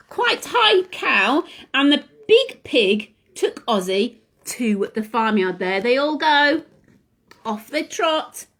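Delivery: usual speaking voice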